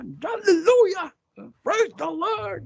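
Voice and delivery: high, drawn-out hammed-up hallelujah; mock preacher shout. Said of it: "Sigaw-sigaw pa".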